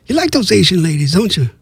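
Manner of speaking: plain reading voice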